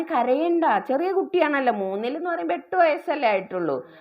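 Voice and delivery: imitating a woman calming a scared eight-year-old; coaxing a crying child. Shopkeeper comforting crying child.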